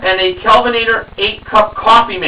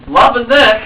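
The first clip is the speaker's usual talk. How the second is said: ordinary talk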